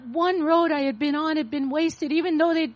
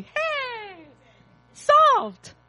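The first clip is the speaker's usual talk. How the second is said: "Hey!" then "Solved!" shouted much higher and louder; mock triumphant shout; 10 semitones higher, 6 dB louder than usual. Preacher mocks her old easy answer with a triumphant shout. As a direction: cheer as if the problem were solved.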